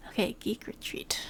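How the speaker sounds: whispered. Real, not acted. Whispered repeat of the name.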